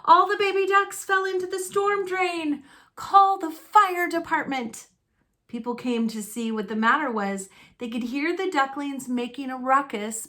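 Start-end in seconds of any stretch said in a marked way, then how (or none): 0.0-4.9 high, urgent plea; a woman urging others to call the fire department; alarmed woman. Acted. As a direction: call out frantic for help. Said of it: "a woman cried".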